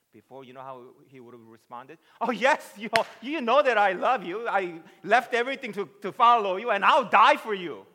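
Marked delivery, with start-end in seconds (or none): 2.2-7.8 loud, high, gushing declaration; brash, over-eager shouted vow; self-confident Peter. Acted. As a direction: proclaim devotion at full volume, utterly sure of yourself. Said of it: "Oh, self-confident".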